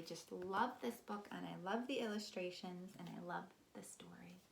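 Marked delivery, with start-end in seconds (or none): 3.0-4.4 whisper. Real, not acted. Tender confiding about the book.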